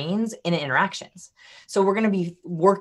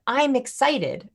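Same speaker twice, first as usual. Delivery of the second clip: excited; 7 semitones higher, much slower than usual. Shown as a demonstration. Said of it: "I can say, use the word".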